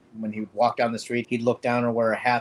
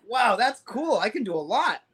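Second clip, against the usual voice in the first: high, bright fake enthusiasm; mock excitement; 9 semitones higher than usual. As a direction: play up being impressed, half-joking. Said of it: "And I was like".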